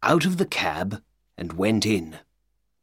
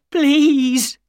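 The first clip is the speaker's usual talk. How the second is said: please cried out, much higher and louder; desperate cry; 8 dB louder than usual. A narrator's reading. Ryder at Holmes's feet cries out please, desperate wail. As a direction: wail please as the begging breaks loose. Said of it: "cried Ryder".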